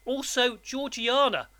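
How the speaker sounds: put-on high, anxious child's voice; timid. A narrator's reading. Boy reads mother's gravestone to convict, timid. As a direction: offer it nervously, pitch well up. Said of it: "I timidly explained".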